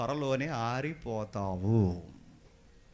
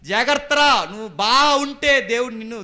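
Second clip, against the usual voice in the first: high, loud acted admonition; loud urgent warning; 10 semitones higher, 14 dB louder than usual. God warning Cain in the preacher's retelling, loud and urgent. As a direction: cry out the warning.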